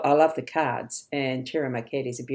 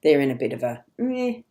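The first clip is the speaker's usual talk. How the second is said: ordinary talk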